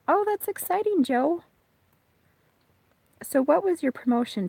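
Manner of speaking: high excited praise for a viewer. Real, not acted. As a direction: cheer him on for his news. Greeting viewer's news excitedly.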